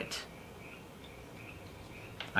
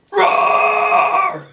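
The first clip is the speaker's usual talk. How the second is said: screamed roar, much higher and louder; monster roar; 7 dB louder than usual. Acted. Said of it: "Why do I keep saying rar".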